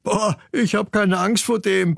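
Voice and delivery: boastful claim. Acted. Chick Ingmar tells his mother he fears no fox, cocky. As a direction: brush the danger off with bravado. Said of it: "behauptete Ingmar".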